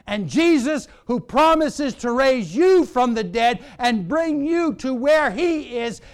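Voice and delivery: high, loud closing proclamation; ringing pulpit climax; full-force preaching. Real, not acted. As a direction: drive it home at a rising shout.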